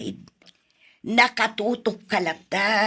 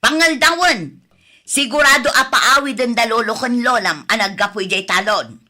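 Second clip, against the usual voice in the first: loud scolding order; 3 semitones higher, 7 dB louder, faster than usual. Acted. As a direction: shout the chore at the dawdling grandchild. Grandmother orders grandchild to cook. (napigsa a panangibilin)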